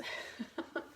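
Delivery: soft breathy chuckle; a quick amused laugh; light laugh. Real, not acted. Laughs at own joke.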